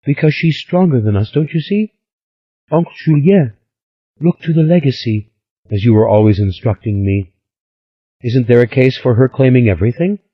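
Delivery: put-on high, lively girlish voice; a lightly voiced excited young Stella; eager young woman. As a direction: spill the gossip breathlessly.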